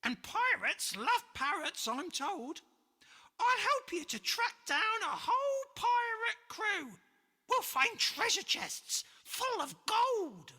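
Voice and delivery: high, loud squawking bird; a chattering parrot, bursting with plans. Acted. Parrot promising George a pirate crew, high squawking. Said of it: "it squawked".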